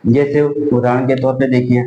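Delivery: ordinary talk, nothing marked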